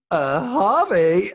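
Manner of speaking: slow, loud, high mock scold; an over-the-top Katie Price telling her son off. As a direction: drag his name out loud and high like a told-off mum. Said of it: "when Katie Price goes".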